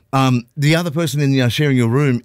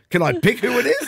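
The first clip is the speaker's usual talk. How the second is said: higher, laughing through a cheeky joke; joking quote; 10 semitones higher than usual. Retold or imitated. Traveller jokes to hotel clerk about roommate, laughing. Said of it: "I foolishly tried a joke".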